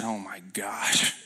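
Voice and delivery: slow, breathed-out exasperation; retold stunned mutter, low and slow; hushed dismay. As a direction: let it out in a drawn-out near whisper. Retells dismay at the confession.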